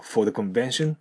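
"for the convention" ending on a rising tune. Shown as a demonstration. Teacher modelling the question tune. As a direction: lift the pitch at the end of "for the convention". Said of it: "尻上がりにするといいでしょう".

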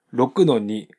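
ordinary talk

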